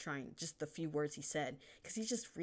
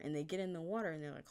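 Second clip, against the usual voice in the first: usual speaking voice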